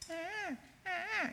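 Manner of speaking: put-on small high baby whimper; thin, high imitated infant cry; imitated baby fussing. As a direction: fuss like a baby in the next room. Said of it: "if that baby in the other room goes".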